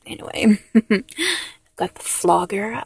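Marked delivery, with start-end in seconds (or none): none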